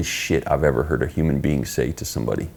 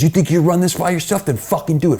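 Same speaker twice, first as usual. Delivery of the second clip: angry yell; 11 semitones higher than usual. Retold or imitated. Boss yelling at him and Keith, angry retold yell. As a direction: bark it like a boss losing it.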